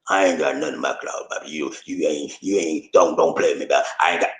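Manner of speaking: low, hammed-up innocent denial; playful low-pitched backpedalling; mock denial. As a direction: deny it jokingly in a low voice. Mock denial of closet secrets.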